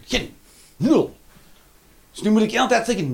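ordinary talk, nothing marked

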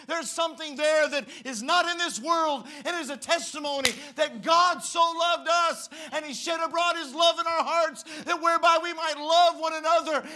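roaring at full force, high and loud; shouting preacher. Acted. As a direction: build to a preaching roar.

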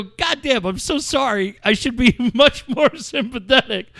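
high, laughing mock apology. Telling the upset friend sorry, laughing. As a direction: apologize while cracking up.

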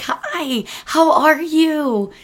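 high, slow sing-song hello; bright chirpy hello, drawn out high; sunny greeting. Retold or imitated. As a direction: beam it at someone grumpy. Cheery greeting to grumpy stranger.

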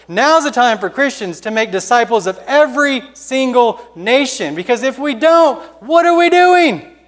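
rising from firm preaching to a high, loud cry; full-force preaching that climbs to a shouted plea; building sermon climax. Acted. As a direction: preach harder and harder until you're shouting the question. Preacher's rising altar call.

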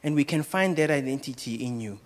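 low, soft, hushed prayer voice. Acted. Closing prayer before the sermon.

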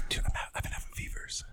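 whispered confession, nearly silent. Real, not acted. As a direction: hush it like a secret. Confessing fevers in hushed tone.

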